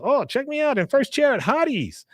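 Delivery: high, gleeful mock bragging; hammed-up crowing in a high voice; showing off. Acted character showing off in the chair.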